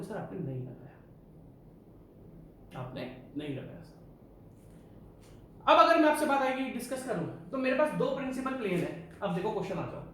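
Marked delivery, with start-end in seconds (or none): 0.0-4.2 a timid student mumbling the answer, dying away; mock student reply. Teacher imitating students answering, low and fading. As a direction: lower and much softer, fading out. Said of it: "हाँ, आप बोलोगे".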